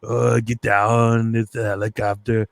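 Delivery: slow, drawn-out bad Austrian strongman accent; Arnold Schwarzenegger. Podcaster showing his awful bit to co-host, hammy Austrian impression. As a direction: do an awful action-star impression, words dragged out. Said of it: "let's hear your Schwarzenegger".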